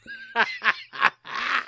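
high burst of laughter; cackling laugh. Real, not acted. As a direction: cackle at your own joke. Cracks up at own Grunberg joke.

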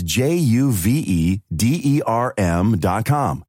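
each letter spelled out slowly. Acted. Ad voice spelling the web address slowly.

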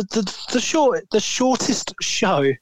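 slow and careful on "shortest show"; slow careful tongue twister. Real, not acted. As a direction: pick through the tongue twister slowly. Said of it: "God, tongue twisters".

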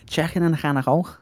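hammed-up Scouse with throaty consonants; Scouse accent. Shown as a demonstration. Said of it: "rendition of a Scouse accent".

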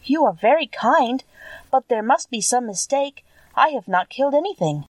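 put-on a young girl unsure, apologizing; lightly voiced hesitant Dorothy. A narrator's reading. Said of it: "said with hesitation".

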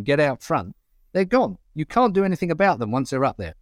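ordinary talk

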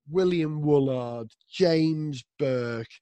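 usual speaking voice

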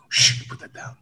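hushed urgent whisper. Retold or imitated. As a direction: whisper it so no one else hears. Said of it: "I go".

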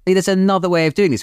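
trailing off in lightly high, mock-polite tones; a hint of a sugary British diplomat; lightly hammed-up British courtesy. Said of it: "that is a British thing of saying".

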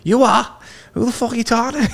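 very high-pitched, argumentative Liverpool impression; mock an indignant Scouser picking a fight; aggressive Scouser. Scouser arguing back at a prank caller, aggressive impression. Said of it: "and they're so aggressive".

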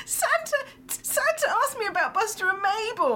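put-on high, thrilled little boy; excited child. Four-year-old son telling mum about Santa, high and thrilled. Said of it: "he was like".

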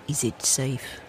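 low, hushed sinister question; Marathon Man quote. Retold or imitated. Quoting the film villain's line, low and hushed. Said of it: "that was in Marathon Man".

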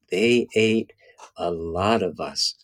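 slow, dropping low and soft at the end; solemn elder storyteller. Retold or imitated. Elder to children round the fire, slow solemn refrain. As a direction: repeat it as the elder, sinking into a hush. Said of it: "he pauses and again makes eye contact".

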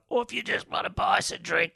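parched, croaky begging voice; thirsty voice. Pretend. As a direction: sound dry and desperate for a drink. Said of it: "that's my thirsty voice".

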